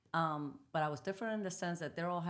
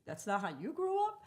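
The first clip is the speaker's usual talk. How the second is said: surprised question; 6 semitones higher, 4 dB louder than usual. Retold or imitated. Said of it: "and you go".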